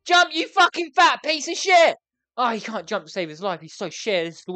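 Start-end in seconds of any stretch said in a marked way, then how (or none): 0.0-2.0 louder, higher, slow angry yell; raging command; furious shout. Real, not acted. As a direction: bellow at the useless character. Yelling at game character.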